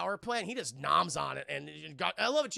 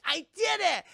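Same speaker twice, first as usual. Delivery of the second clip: high, loud cry of victory; squealed retold celebration; mock triumph; 12 semitones higher, 10 dB louder than usual. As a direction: imitate a girl exulting in success. Retold triumph on removing box.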